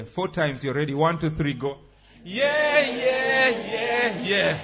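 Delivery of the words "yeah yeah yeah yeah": high loud yeah, yeah; shouting yeah. Shown as a demonstration. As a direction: shout it out with the head back.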